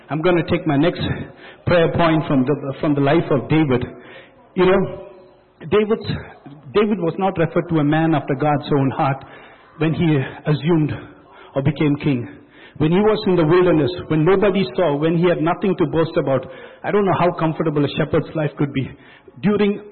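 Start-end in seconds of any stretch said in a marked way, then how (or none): none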